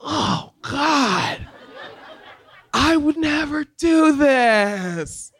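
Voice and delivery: high, slow horrified laugh. Retold or imitated. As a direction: recoil in mock horror. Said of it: "I'm like".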